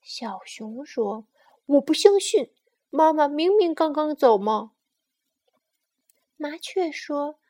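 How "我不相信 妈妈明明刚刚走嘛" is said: loud, higher-pitched cub's protest; indignant disbelief. Acted. Bear insists mama just left, indignant. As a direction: insist crossly, refusing to believe. Bear refuses to believe the sparrow.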